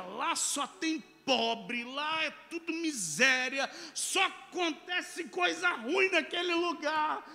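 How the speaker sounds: high, loud, slow emphatic mockery; insistent shouting. Stranger insisting to his friend the place is miserable, loud insistent shouting. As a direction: hammer each insult out loudly and slowly.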